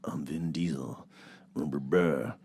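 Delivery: low, whispered one-note Vin Diesel; mock his flat monotone, quiet; a flat mumbled movie star drone. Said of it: "he's one note all the time".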